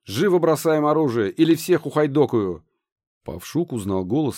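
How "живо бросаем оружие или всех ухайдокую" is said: loud, high barked threat; harsh command. A narrator's reading. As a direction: bark the order at the soldiers. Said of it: "не менее жёсткий приказ".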